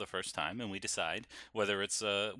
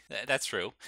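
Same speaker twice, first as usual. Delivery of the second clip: ordinary talk, nothing marked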